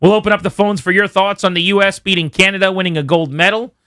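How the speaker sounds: ordinary talk, nothing marked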